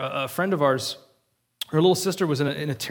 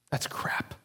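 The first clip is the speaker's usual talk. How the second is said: very soft, voiceless dismissal; whispered rejection; 10 dB quieter than usual. Real, not acted. Preacher rejecting blame for a girl's death, whispered. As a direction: whisper it as a grave verdict.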